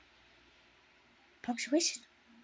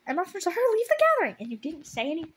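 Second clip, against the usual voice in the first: higher, louder exasperated rant; outraged rant; 7 semitones higher, 6 dB louder than usual. Real, not acted. Reader outraged at the book's mistake, higher and louder. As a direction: vent in disbelief at the plot. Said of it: "outraged at the plot".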